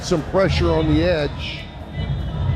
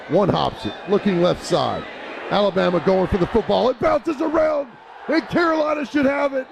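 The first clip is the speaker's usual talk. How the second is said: high, shouting onside kick call; excited play-by-play; 10 semitones higher than usual. Real, not acted. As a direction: yell the recovery as it happens.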